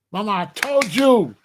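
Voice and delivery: ordinary talk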